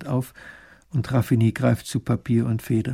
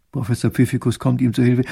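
plain reading voice

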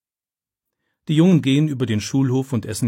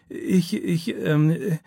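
higher, halting stammer; 6 semitones higher, much slower than usual. A narrator's reading. Startled boy stammering to the teacher, higher and halting. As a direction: trip over the first word in fright. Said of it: "stammelt er".

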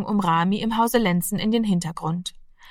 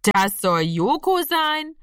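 slow, higher, unbelieving question; doubtful; 7 semitones higher, slower than usual. A narrator's reading. Brother seeing the scruffy cat, slow doubtful question. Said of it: "zweifelte Horst".

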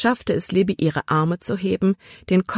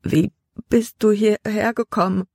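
teeth chattering; 5 semitones higher than usual. A narrator's reading. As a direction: ask shivering with cold. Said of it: "mit klappernden Zähnen".

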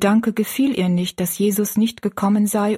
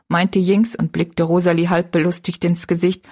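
plain reading voice

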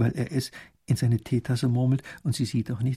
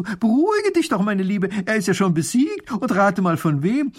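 high, loud call after her; 8 semitones higher, 6 dB louder than usual. Acted. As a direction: call her back, excited. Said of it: "Mathilde ruft ihr zu".

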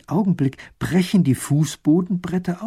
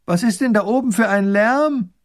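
higher, louder call from afar; 8 semitones higher, 7 dB louder than usual. Acted. Princess calling up the hill, loud and high. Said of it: "Die Prinzessin ruft vom Fuß des Hügels".

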